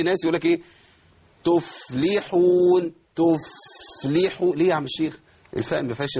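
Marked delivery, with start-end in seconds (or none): 1.4-4.5 تفلحون with the fa held far too long, slowly; mock overlong fa in تفلحون. Shown as a demonstration. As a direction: stretch the fa in تفلحون. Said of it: "أقف على الفاء، فاء زمن طويل".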